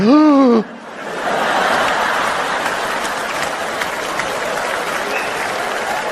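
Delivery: sharp mock gasp of amazement; acted gasp of recognition; startled gasp. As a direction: gasp as if you spotted someone rare. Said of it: "we're like".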